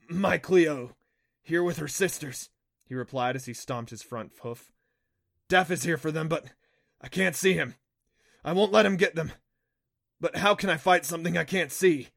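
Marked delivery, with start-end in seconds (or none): 0.1-2.5 loud, raised voice of an upset creature; urgent, worked-up Kelpie; agitated reply. Acted. Agitated Kelpie answers, stomping his hoof. 5.5-12.1 loud, high, desperate anger; agitated, embattled Kelpie; frantic protest. Acted.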